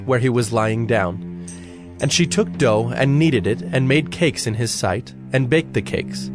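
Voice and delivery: ordinary talk, nothing marked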